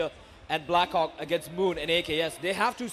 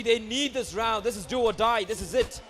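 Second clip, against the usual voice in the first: ordinary talk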